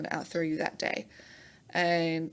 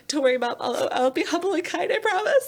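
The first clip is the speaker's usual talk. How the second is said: high, loud, giggling reassurance; laughing promise; 11 semitones higher, 6 dB louder than usual. Retold or imitated. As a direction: promise it through laughter, pitched way up. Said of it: "so I was like".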